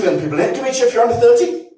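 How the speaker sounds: usual speaking voice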